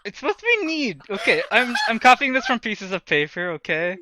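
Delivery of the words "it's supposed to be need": 1 emphatic correction. Real, not acted. Correcting a misread message.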